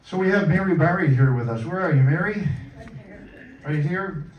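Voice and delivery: ordinary talk, nothing marked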